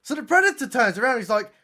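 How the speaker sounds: ordinary talk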